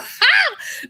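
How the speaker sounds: high burst of laughter; loud, high cackling laugh. Real, not acted. Laughing at four A M joke.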